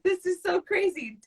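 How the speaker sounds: high excited rush; starstruck. Retold or imitated. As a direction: gasp it out, starstruck. Fan freaking out on phone.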